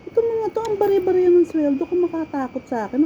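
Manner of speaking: high, scornful mockery of the managers; scornful inner jab. Thinking about her bullying managers, a high scornful sneer. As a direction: sneer at the underpaid bosses. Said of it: "Tinakot ko talaga sa isip ko".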